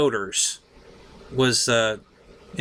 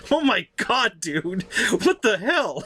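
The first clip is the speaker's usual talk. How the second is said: higher, slow, laughing through the words; laughing disbelief; 7 semitones higher, slower than usual. Retold or imitated. Driver after the practice run, laughing in disbelief. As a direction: laugh it out in amazement. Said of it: "And he just starts laughing".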